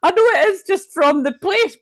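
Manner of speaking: high, loud, freaked-out voice; spooked amazement. Real, not acted. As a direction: cry out as chills hit you. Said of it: "I've got chills. That's creepy".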